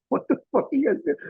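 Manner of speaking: high, fast mock bark; imitating angry cop. Cop catching pranksters, high fast mock yell. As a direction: cop demanding answers, high. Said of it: "he goes".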